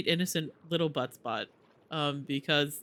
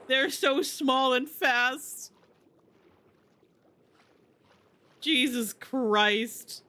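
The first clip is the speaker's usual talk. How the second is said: high, slow, shaken panic; terrified; 8 semitones higher, much slower than usual. Real, not acted. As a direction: drag out the words in fright. Tiny fast zombie terrifies her.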